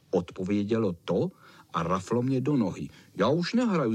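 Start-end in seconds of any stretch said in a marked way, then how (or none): 3.2-3.9 mildly cross, raised pitch; annoyed. A narrator's reading. Bitten dog quits the guessing game, mildly cross. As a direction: protest with a touch of temper. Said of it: "zlobil jsem se".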